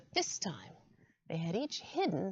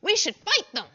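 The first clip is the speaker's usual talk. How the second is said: squirrel voice; 9 semitones higher, 9 dB louder than usual. Acted. Squirrel council, bold call.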